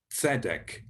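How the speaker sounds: Tzedek said with a crisp ts at the start; Tzedek, ts then a short open e, stress on dek; Hebrew tzedek. Shown as a demonstration. Teacher models Tzedek for learners, crisp ts. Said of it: "like in the word tzedek".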